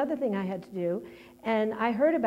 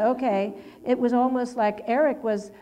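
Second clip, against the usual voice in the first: ordinary delivery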